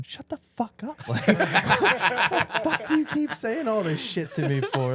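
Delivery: high, exasperated inner rant breaking into a laugh; fed up, mocking your own thoughts; mock annoyed inner voice; inner irritation. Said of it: "sitting in my head going".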